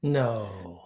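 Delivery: low, soft, drawn-out sarcastic no; mock sarcastic denial. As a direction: feign disbelief, dropping low and long. Deadpan reply to sarcastic bunch.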